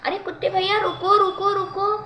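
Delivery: high, loud shout to stop; urgent cry. Acted. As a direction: cry out stop before he bites. Bird halts the dog.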